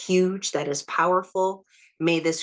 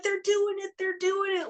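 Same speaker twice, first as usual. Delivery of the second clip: excited cheering. Retold or imitated. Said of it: "being like".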